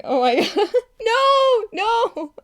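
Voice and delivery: high, loud cry of protest; mock horror. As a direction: wail in comic horror.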